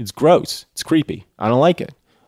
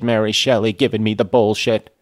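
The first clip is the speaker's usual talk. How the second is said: usual speaking voice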